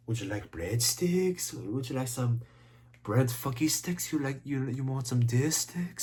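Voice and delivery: lightly imitated waiter offering food; a hint of a put-on waiter voice. Reviewer voicing [name]'s waiter offering breadsticks, light impression. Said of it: "[name] voices a, uh, a guy named [name]".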